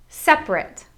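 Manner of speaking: "Separate" as SEP-rit; high-starting "Separate" in two beats, SEP-rit; two syllables. Shown as a demonstration. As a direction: drop the middle vowel of "Separate". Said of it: "make it two syllables".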